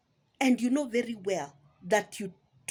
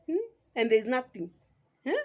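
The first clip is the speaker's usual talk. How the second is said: ordinary talk, nothing marked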